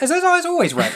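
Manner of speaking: squeaky mock of a friend's question; high laughing imitation of a friend; mocking high quote. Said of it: "she was like".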